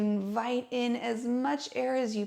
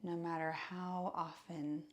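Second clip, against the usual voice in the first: guided meditation; 2 semitones lower, 7 dB quieter than usual. Acted. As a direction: guide listeners gently into stillness, hushed and unhurried. Leads a seated breathing meditation.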